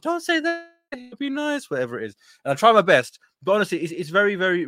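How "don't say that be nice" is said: high-pitched mock wife voice; mimicking wife. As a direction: squeak it out like a scolding wife. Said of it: "My wife's like".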